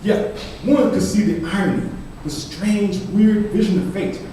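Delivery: emphatic spoken-word delivery; forceful poem recitation. Acted. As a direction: declaim it with force to the room. Reads own poem aloud.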